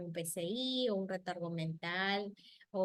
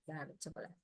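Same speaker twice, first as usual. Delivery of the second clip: "Dame chocolate" said much softer and lower, gently; soft correct model; 5 semitones lower, 11 dB quieter than usual. Shown as a demonstration. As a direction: quietly model the right way to say it. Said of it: "yo corrijo el patrón".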